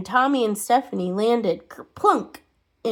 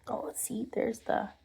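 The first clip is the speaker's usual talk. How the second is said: usual speaking voice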